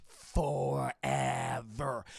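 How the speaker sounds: very low, drawn-out growly doom; ominous Beast. Retold or imitated. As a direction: draw it out deep and slow like a monster. Beast repeats the sentence.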